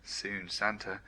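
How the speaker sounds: ordinary delivery, nothing marked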